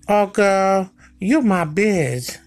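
high-pitched mock girlfriend voice; woman to woman. Man mimicking women talking to women, high drawn-out voice. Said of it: "like the way women say it to women".

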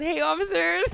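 high-pitched hello, acting out greeting police; playful retold greeting. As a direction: call it out high, imitating a cheeky greeting.

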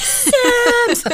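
high, loud gleeful yell; excited shout. Pretend. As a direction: yell it out with glee. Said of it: "knock my whole studio over and be like".

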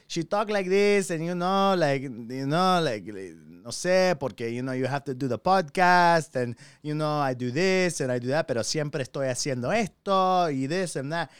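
put-on Spanish-accented mom voice; swooping Spanglish mother, sliding between languages; impersonation of mother. Son showing how mom talks, accented impersonation. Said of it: "me do an impersonation of her".

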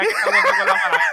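squealing high through laughter; laughing. Real, not acted. Laughing punchline of a joke.

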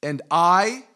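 slow, loud, pointed scripture reading. Shown as a demonstration. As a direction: read it out loudly, word by word. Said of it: "read it really slow".

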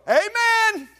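high, loud churchy amen; mock hearty amen. Preacher mocking the churchgoer who only says amen, hammed-up. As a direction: ham up a congregation amen. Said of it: "and we go".

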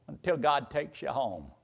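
usual speaking voice

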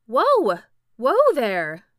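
loud call to a runaway horse. A narrator's reading. Boy calling out to the prancing Sawhorse, loud. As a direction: shout it out to stop him. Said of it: "so he called out".

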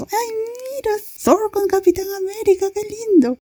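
high-pitched, mocking fangirl squeal; gushing female classmates. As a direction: put on a sugary high voice, imitating gushing friends. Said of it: "Y las compañeras". (voz aguda imitando a compañeras embobadas)

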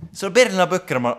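high, loud excited outburst; really loud. Real, not acted. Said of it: "mä kuusin tosi kovaa".

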